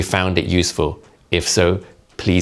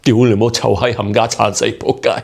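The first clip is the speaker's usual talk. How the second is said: higher Cantonese swearing in one long breath; running out of breath; 4 semitones higher than usual. Shown as a demonstration. Comedian showing a long Cantonese swear string, running out of breath. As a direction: rattle the curse string out until breath runs out. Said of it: "we run out of breath before we finish them".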